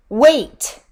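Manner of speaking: sudden loud high shout; terrible cry. A narrator's reading. A terrible underworld voice halting Inanna, shouted. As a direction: stop her dead with one shout. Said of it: "cried a terrible voice".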